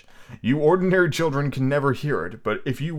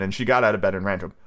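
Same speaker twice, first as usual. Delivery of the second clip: ordinary delivery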